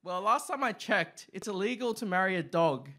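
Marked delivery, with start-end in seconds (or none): none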